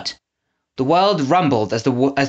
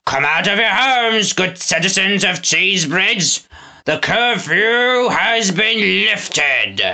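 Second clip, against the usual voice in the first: town crier proclamation; 6 semitones higher, much slower than usual. A narrator's reading. Snatcher proclaims over the loudspeaker to the citizens. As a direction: announce it to the whole town.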